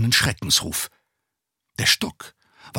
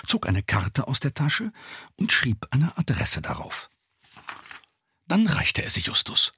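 normal talking voice

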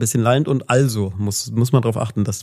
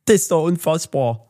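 mock East German accent; 5 semitones higher than usual. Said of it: "mit so einem ostdeutschen Akzent".

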